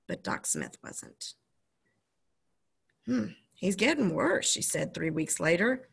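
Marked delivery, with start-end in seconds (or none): 3.0-4.5 lightly voiced country doctor; a hint of a grave doctor. Doctor telling the boy bad news, gravely.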